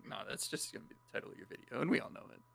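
whispered teasing aside; whispering. Real, not acted. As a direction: whisper it behind a hand.

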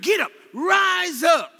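from firm calls to high, loud shouts; building rousing command. Acted. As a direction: rouse the sleepers, climbing to full-force preaching. Beloved's call, preached building to shout.

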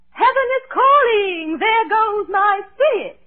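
high, slow, wailing mock farewell; melodramatic deathbed line. Shown as a demonstration. Showing Topper how to fake a deathbed farewell, wailing. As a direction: ham up a dying man's last words. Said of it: "all you have to do is say".

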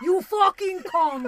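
loud, slow mock-Russian insult; bad Russian accent. Ivan cursing out a lover, loud bad Russian accent. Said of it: "Your Russian sucks".

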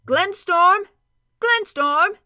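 loud, high call across the glen. Acted. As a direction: call his name into the valley. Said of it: "called again".

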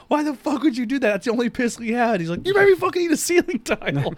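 high, outraged yell at a prank; mock outraged yell. Retelling a sitcom scene, a furious high yell. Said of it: "And he's like".

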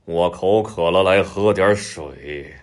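drawn-out big-cat villain voice; self-satisfied tiger with a laugh midway; gloating tiger. A narrator's reading. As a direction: savour your prey slowly, as a tiger. Tiger gloats over fox as lunch.

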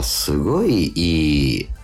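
ordinary talk

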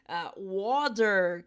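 water slow and loud with the final R sounded; American water. Shown as a demonstration. Teacher demoing American water with the final R. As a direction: stretch water out and pronounce the R at the end. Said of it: "a gente pronuncia esse R no final".

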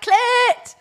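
high, loud playground yell; yelling down the corridor. Retold or imitated. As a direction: call it out across the corridor like a schoolgirl. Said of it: "I'd yell down the corridor".

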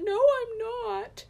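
put-on very high, loud, slow child denial; stubborn little boy insisting, drawn out; pouting toddler. Son denying he is angry to mom, high and pouty. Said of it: "And he said".

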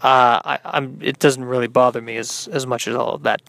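normal talking voice

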